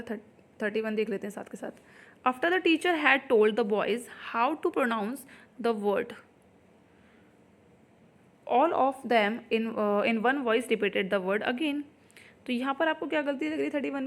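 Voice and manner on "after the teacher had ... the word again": slow, word by word sentence reading; slow dictation read. Shown as a demonstration. Teacher reading the exam question to students, slowly. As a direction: spell the sentence out for the class.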